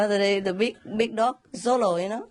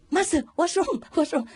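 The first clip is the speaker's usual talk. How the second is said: worried disciple; 9 semitones higher than usual. Retold or imitated. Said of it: "he was worried".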